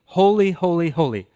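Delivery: ringing threefold cry; seraphim's call. Acted. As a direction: call it out high and strong, settling on the last word. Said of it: "the seraphim sing".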